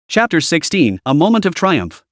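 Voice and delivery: usual speaking voice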